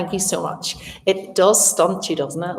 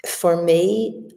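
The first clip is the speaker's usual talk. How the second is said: ordinary delivery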